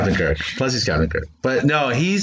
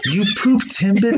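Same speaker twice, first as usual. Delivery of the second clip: incredulous; 9 semitones higher, slower than usual. Real, not acted.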